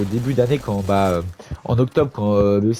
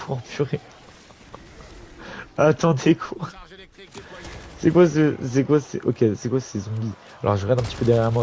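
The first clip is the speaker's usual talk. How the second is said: ordinary talk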